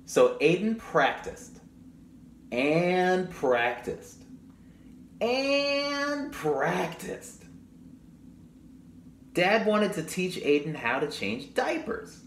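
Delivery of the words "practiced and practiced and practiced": drawn-out repetition. A narrator's reading. Repeated practiced, drawn out.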